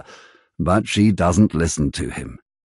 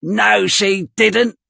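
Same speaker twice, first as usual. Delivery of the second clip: higher, slow, mocking retort; Noah, shouting back; 11 semitones higher, slower than usual. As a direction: drag it out to wound him. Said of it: "shouts Noah".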